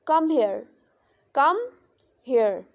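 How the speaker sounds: "come" lifted, then "here" dropping; rising tone on come. Shown as a demonstration. Teacher showing students rising tone, stress on "come". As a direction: split "come" from "here", lifting "come". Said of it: "come है इसमें ... rise करती है".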